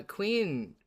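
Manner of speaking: usual speaking voice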